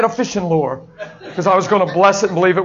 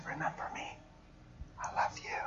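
soft, breathy whisper of love; tender intimate whisper; 23 dB quieter than usual. Acted. God speaking to the weeping young woman in a tender whisper.

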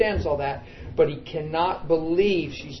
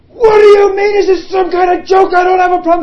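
high, loud indignant yelling; outraged shout; 15 dB louder than usual. Retold or imitated. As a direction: rage at God, disbelieving. Outraged husband retold.

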